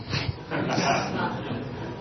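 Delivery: quiet unvoiced chuckle; a barely audible amused laugh; soft laugh. Real, not acted. Laughs after grandma's reply.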